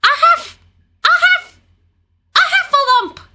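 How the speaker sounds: high squeaks in broken bursts; Piglet out of breath. A narrator's reading. Piglet tells Christopher Robin, winded, in high panicked stammers. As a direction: struggle to get the word out, squealing. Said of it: "breathing so hard that he could hardly speak".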